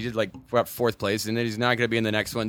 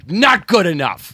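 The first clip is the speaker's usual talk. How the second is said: high, loud, slow sniffy verdict; snooty dismissal; 7 semitones higher, 10 dB louder, slower than usual. Retold or imitated. Mocking a snooty rejection of a name, loud slow sniffy voice. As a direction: look down your nose and reject it. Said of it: "Cadillacs going".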